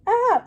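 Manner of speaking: a very high, loud scream; the girl screams back at the chef; girl's scream. Acted. Shrill startled shriek. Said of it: "she screamed".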